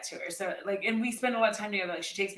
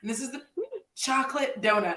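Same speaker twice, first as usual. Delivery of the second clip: ordinary talk, nothing marked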